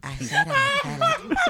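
character impression. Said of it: "[name]'s impressions is one of my favorite things".

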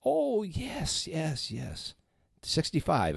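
put-on high, lilting old lady voice; lightly voiced elderly teacher; old teacher remembering. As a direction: recall the name with dawning recognition. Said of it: "She went".

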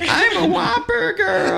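very high falsetto answer; silly, very high voice; Mona Lisa talking. Pretend. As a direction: play the painting in a squeaky puppet voice. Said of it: "Hey, Mona Lisa".

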